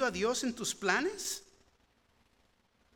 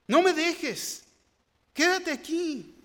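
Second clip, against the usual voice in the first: pleading; 5 semitones higher, slower than usual. Acted. Jacob pleading with God.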